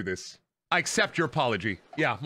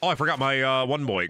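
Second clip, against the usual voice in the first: usual speaking voice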